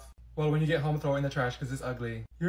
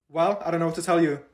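normal talking voice

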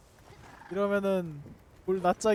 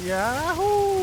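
excited cheer; 10 semitones higher, much slower than usual. Real, not acted. Celebrating a hit.

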